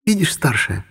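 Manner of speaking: high squeaky little voice. A narrator's reading. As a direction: squeak it out like a tiny elf. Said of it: "пропищала Эльфи".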